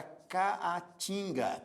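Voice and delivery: "caatinga" with both As sounded separately; syllable by syllable. Shown as a demonstration. Teacher introducing the example word, "caatinga" slow with its two As separated. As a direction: stretch "caatinga" slowly as ca, a, tinga. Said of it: "ficam em sílabas diferentes".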